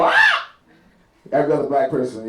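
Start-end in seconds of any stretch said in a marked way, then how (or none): 0.0-0.5 short, very high movie-victim scream; imitated scream. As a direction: shriek like someone flung through the air. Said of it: "you just see her go".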